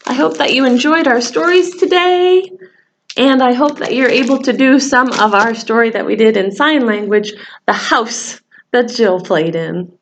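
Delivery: usual speaking voice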